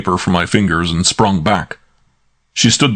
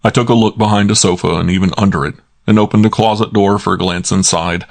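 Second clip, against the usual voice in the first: usual speaking voice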